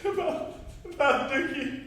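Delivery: halting, tearful apology; breaking down. Acted. Apologizing to a friend about Dickie, haltingly tearful. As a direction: struggle to speak through rising tears. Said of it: "if you didn't break down like this".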